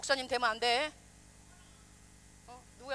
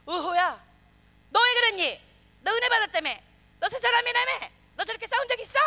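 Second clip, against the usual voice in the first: scolding God; 7 semitones higher, 8 dB louder than usual. Retold or imitated.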